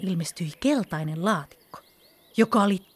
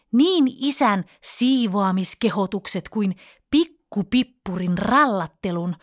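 plain reading voice

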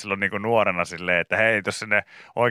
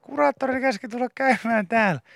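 put-on high-pitched little boy; child voice; 13 semitones higher than usual. Pikku Pekka telling the nurse about his parents, high little-boy voice. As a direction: speak like a small troubled kid.

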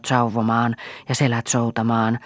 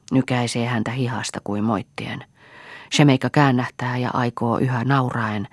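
ordinary delivery

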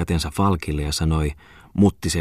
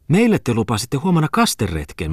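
higher-pitched, insistent reminder; exclaiming; 6 semitones higher than usual. A narrator's reading. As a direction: pipe up in a raised pitch. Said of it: "Mutta Mimmi huudahti". (huudahtaen, korkeammalla äänellä)

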